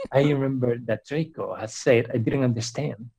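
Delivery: ordinary talk, nothing marked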